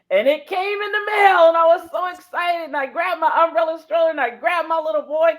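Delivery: high, loud, rushing with excitement; bursting with glee over the package; excited. Retold or imitated. Telling John how the wig arrived, thrilled and high.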